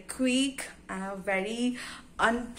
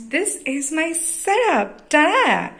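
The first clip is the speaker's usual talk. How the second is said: excited reveal; 7 semitones higher, 6 dB louder than usual. Real, not acted. Showing off the setup.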